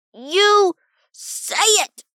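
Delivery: high, loud, drawn-out demand; screamed. A narrator's reading. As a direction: scream the order, stretching each word. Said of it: "Rob screamed into the silence".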